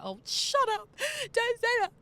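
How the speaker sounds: high, loud mock protest; teenage outburst at a parent, played up; exasperated retort. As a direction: push back loudly, imitating an upset teen. Acts her teenage retort to dad.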